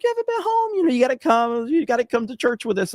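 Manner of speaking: high-pitched imitation of a nagging mother; pleading mom. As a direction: plead like mom guilting her grown child. Acting out mom pleading with him.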